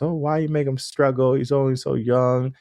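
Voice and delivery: a lightly voiced fretting parent; hint of an anxious parent pleading; imitating doubtful parents. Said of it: "I understand some parents".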